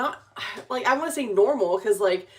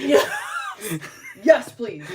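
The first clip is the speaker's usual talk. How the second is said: laughing eager yes; 6 semitones higher, slower than usual. Retold or imitated. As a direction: gush yes like you can't wait, laughing. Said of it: "And I was like".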